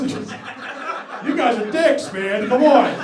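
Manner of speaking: loud, high-pitched protest; mock-hurt scolding. Real, not acted. Comic scolding a laughing audience over jibes, loud and exasperated. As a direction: cry out at them in exasperation.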